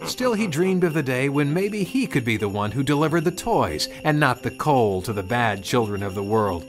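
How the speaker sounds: plain reading voice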